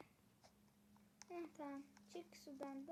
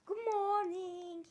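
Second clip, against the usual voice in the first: sing-song greeting; 3 semitones higher, 10 dB louder, slower than usual. Pretend. Sing-song English greeting in game.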